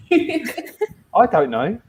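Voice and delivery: slow, laughing through the words. Real, not acted. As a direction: giggle it out slowly. Teasing banter about paladin.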